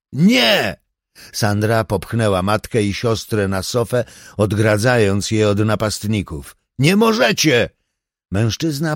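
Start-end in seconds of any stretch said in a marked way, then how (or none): 0.1-0.8 high, loud refusal; shouted no. A narrator's reading. Sandra protests the threat. 6.8-7.7 high, loud protest; desperate shout. A narrator's reading. Sandra shields her family.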